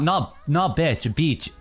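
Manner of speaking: normal talking voice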